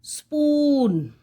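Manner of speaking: spoon with a long drawn-out oo; stretched oo. Shown as a demonstration. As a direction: hold the oo in spoon. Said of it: "we are stretching the oo sound".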